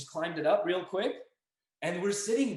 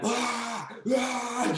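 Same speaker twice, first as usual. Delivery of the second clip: scaring-off yell; 8 semitones higher, 4 dB louder than usual. Retold or imitated. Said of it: "Buddy's screaming at him".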